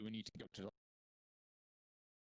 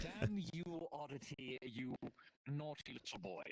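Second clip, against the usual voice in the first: playful scold, pitched up and louder; mock scolding; 5 semitones higher, 4 dB louder, much slower than usual. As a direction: tease him like a cross nanny. Mock scolding a friend in chat.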